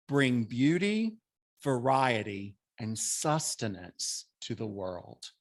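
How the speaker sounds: slow, measured prayer reading with pauses; prayer recitation. Acted. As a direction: recite it reverently, phrase by phrase. Recites a short Buddhist prayer.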